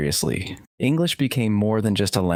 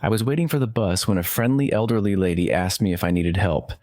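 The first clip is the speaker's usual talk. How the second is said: ordinary delivery, nothing marked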